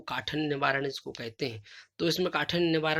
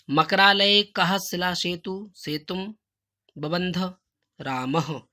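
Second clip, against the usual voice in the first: slow recitation; 3 semitones higher, 5 dB louder, much slower than usual. Shown as a demonstration. Teacher repeats Sanskrit answer.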